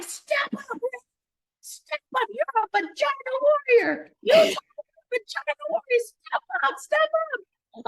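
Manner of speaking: very high, shouted mock coach cheer. Coach at the bottom shouting up the pole, very high. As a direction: yell encouragement up the pole. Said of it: "You're like".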